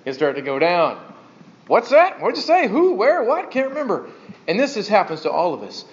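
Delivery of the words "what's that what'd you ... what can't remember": high, rattled string of questions; forgetful old person. Acted. Preacher mimicking failing memory for the congregation, high and confused. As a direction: play someone losing track, pitched well up.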